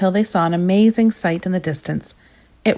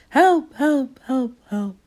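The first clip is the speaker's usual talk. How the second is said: echo; 7 semitones higher, much slower than usual. A narrator's reading. The echo of Mister Noisy's shout rolling around the chamber, fading. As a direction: let it ring off the chamber walls and die down. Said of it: "a terrible, deafening echo".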